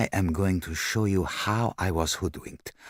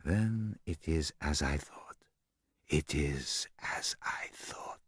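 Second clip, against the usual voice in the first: soft and slow, fading to a whisper; whispered musing; 4 semitones lower, 10 dB quieter, slower than usual. A narrator's reading. Poirot confirms his suspicion to himself, whispering. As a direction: murmur it to yourself.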